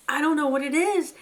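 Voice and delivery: high, loud, miserable answer; wretched. Acted. Fiver answering Hazel's questions, wretched and afraid. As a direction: answer like a rabbit overwhelmed by dread. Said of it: "answered Fiver wretchedly".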